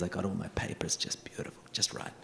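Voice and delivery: soft whispered aside, voice barely there; hushed aside. Retold or imitated. Whispering to the audience about his perfect papers. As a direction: breathe it like a secret.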